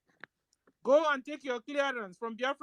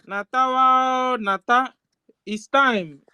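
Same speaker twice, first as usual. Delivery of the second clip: calling out; 9 dB louder, slower than usual. Real, not acted. Calling out the name.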